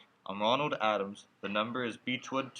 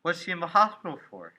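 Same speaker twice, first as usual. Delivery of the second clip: higher, louder anxious demand; 5 semitones higher, 5 dB louder than usual. Acted. Son pressing the stranger about his mother, anxious. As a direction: press for an answer, worried.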